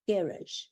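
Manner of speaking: garage said GARR-izh, stressed on the first syllable; garage with English first-syllable stress; English pronunciation. Shown as a demonstration. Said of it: "they say it in England with an English accent".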